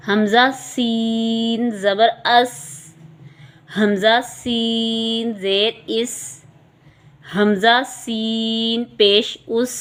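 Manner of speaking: سین drawn out slowly, each letter clear; teacher leading slow repetition of سین; slow spelling drill. Shown as a demonstration. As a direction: spell it slowly for the class to echo. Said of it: "آپ میرے ساتھ ساتھ پڑھیے گا".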